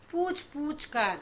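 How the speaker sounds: पूछ पूछ said slowly, with weight on पूछ; stress on repeated word. Shown as a demonstration. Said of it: "यहाँ भी जोर पड़ रहा है". (पूछ पूछ पर धीरे से ज़ोर)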